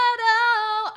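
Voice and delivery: arao flipped up high and loud; flip squeak. Shown as a demonstration. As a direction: squeak up into head voice. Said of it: "The little flip".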